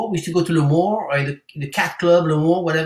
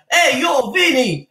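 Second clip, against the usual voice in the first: higher, louder retold New York Italian call; New York Italian accent; 9 semitones higher, 4 dB louder, slower than usual. Singer retelling calling to Brooklyn strangers in New York Italian. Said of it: "I'm like".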